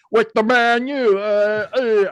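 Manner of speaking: loud, high, hammed-up English accent; mock English accent. Imagined Man United fan objecting to jersey color, mock English accent. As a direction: play an upset English football fan, pitched high. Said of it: "I don't know why that's my English accent".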